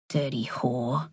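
low, quiet muttered insult; muttering. A narrator's reading. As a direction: mutter it under your breath. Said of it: "mutters another under his breath".

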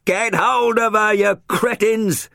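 high, loud, stretched-out insult; yelling. A narrator's reading. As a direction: winchman yelling down at soldiers from a helicopter. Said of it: "the winchman was yelling".

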